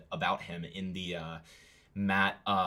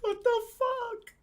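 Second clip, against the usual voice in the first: high drawn-out disbelief; shocked; slower than usual. Real, not acted. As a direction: gasp it out in shock. Shocked reaction to the song.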